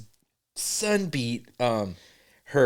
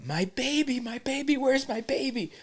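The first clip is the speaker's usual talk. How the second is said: high, softened frantic wail; mock yelling; 10 semitones higher, 5 dB quieter than usual. Imitating the woman searching for her baby, mock yell. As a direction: imitate a panicked woman in a much higher voice. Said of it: "she's yelling".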